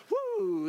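high "woo"; high whoop. Acted. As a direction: let out a high, sustained woo. Reacts to light from heaven.

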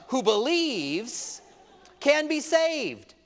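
who believes stretched slowly, can punched high; drawn out with rising fervor; slow, fervent emphasis; slow emphatic. Real, not acted. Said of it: "trying to calm myself down".

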